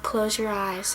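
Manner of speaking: soft, subdued imitation of a sad man; hushed mock-sad movie line; acted sadness. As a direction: say it quietly, with a touch of sorrow. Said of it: "he's sad, you can tell".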